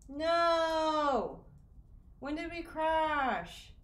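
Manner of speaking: loud, high, slow cry of dismay; dismayed shout. Real, not acted. Streamer finding the stream crashed, dismayed shout. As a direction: wail at the crash.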